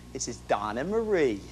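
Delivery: ordinary delivery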